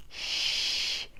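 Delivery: soft unvoiced shush; playful whispered shh; hushing whisper. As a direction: hush the little listeners. Hushing children while searching.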